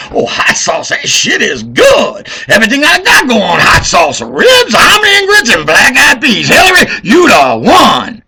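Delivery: high, loud hammed-up caricature shout; mock voter voice. Mocking voters cheering Hillary, loud high caricature. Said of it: "Pandering to African Americans and what they think of".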